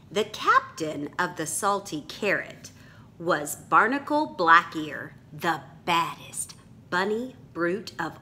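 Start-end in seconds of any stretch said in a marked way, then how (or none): none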